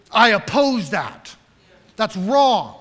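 loud, slow, firm objection; a righteous man loudly protesting a wrong; voicing anger. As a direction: plant your feet and object, loud and slow. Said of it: "Anger is".

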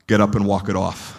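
a no-nonsense dad telling his son to tough it out; gruff dad. Retold or imitated. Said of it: "the beautiful and tender voice".

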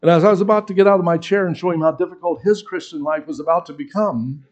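ordinary delivery

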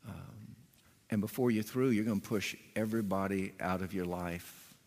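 soft, gentle, quiet loving correction; tender rebuke. Pretend. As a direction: speak hard truth softly, like to a dear one. Imagined loving rebuke to Annie Mae.

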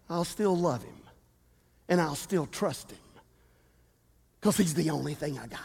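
soft, low, sincere vow; tender devotion. Acted. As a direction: say it quietly like a promise. Quoted faith declaration softened.

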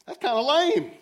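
high, loud mock letdown; unimpressed. Preacher voices his own unimpressed face at the workout, high and loud. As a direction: scoff like it's beneath you. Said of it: "the look on my face was like".

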